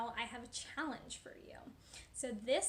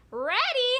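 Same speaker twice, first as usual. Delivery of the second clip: high, loud crow of mock disbelief; pig's gleeful cry; 12 semitones higher, 13 dB louder than usual. Pig mocking the wolf at his door, crying out. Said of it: "cried the little pig".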